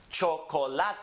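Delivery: chocolate in Spanish, cho-ko-LA-te; Spanish next-to-last stress. Shown as a demonstration. Teacher models a word for students, chocolate stressed on LA. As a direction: stress the LA in chocolate, every vowel pure. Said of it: "it's the next to last syllable that's emphasized".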